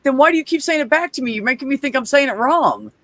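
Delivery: high-pitched exasperated protest, hurried at the start; exasperated retelling. Retold or imitated. Student challenging the one correcting their pronunciation, exasperated.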